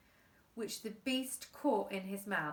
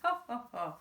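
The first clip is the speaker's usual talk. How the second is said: little laugh. Acted. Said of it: "sounded like a little laugh".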